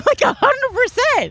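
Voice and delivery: mock high voice. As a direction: squeak it out playfully. Silly high voices joking.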